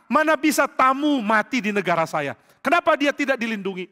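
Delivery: a king outraged at the news; shocked outrage. Acted. David demands to know why his guest was not protected, outraged.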